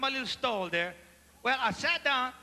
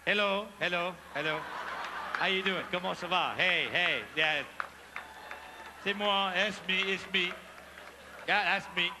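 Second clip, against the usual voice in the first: sheepish greetings; 6 semitones lower than usual. Retold or imitated. Greeting diners while embarrassed.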